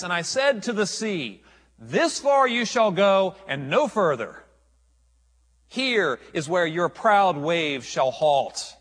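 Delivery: loud, slow, towering divine challenge; God's booming voice. Acted. God rebuking Job from the storm, loud and slow. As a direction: thunder it down like the Almighty. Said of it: "God looks down at Job and says".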